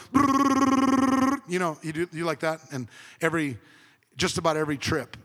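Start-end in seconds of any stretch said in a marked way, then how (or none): 0.1-1.4 loud, high rolling "brrrr"; rumble-strip noise. Retold or imitated. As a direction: imitate tyres buzzing over road grooves. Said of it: "it goes".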